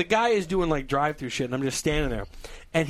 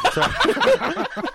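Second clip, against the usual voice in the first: high drawn mock apology; much slower than usual. Puncher to friend, drawn-out mock apology. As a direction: squeak it out slowly.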